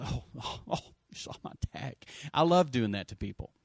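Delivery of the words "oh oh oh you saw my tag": breathy near-whisper of a caught clerk; sheepish realization. Retold or imitated. To the clerk called by name, a whispered oh you saw my tag. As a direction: hush it like you just got caught out. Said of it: "and then they realize".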